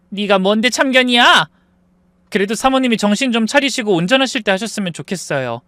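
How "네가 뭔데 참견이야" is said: angry snap. Retold or imitated. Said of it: "하면서 화를 내더라고요".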